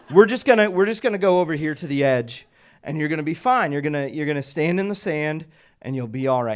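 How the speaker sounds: loud, high-pitched firm instructions; football voice. Retold or imitated. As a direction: take command of a panicking swimmer. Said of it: "I use my football voice".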